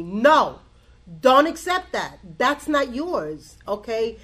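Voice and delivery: loud, emphatic telling-off; retold scolding. Friend scolding her for taking on his burden, firm and loud. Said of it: "He literally scolded me".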